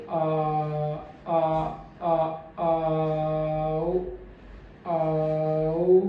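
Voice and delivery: आ with relaxed lips, then rounding into आओ; relaxed-lip demo. Shown as a demonstration. Teacher shows relaxed lips before rounding. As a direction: keep the lips loose until the glide. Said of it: "पहले वाली sound में मेरे lips बिल्कुल relax".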